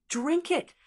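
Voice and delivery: high-pitched command at normal volume; excited command. A narrator's reading. Excited elder seizing the power, high eager command. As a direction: raise the pitch sharply, eager villain's order. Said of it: "became even more excited".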